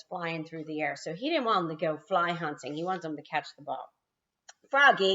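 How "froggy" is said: louder sharp call; coach yelling. A narrator's reading. Coach yelling at Froggy in the outfield. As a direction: call his name across the field. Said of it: "yelled the coach".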